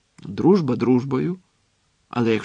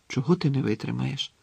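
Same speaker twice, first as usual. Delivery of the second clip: usual speaking voice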